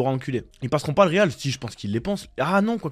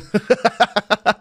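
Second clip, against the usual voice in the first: real cackling laugh; laughing fit. Real, not acted. Streamer cracking up at the chat's ban joke, laughing.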